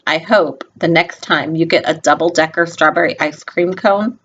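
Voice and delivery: usual speaking voice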